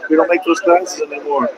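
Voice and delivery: lightly voiced dismissive employee; mock store clerk. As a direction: play the unhelpful clerk brushing him off.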